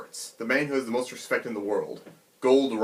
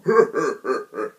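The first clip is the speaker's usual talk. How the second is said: mocking bandit laugh; 5 dB louder than usual.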